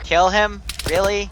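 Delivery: incredulous. Real, not acted. Teammate orders killing a civilian.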